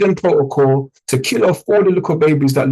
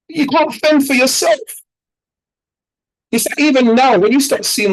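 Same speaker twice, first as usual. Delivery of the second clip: heated emphasis; 10 semitones higher than usual. Real, not acted. Preaching about ignorant investors.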